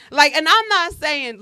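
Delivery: usual speaking voice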